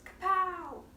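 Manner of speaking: punchy, high, loud mock sound effect; comic-book exclamation. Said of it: "above somebody's head and it says".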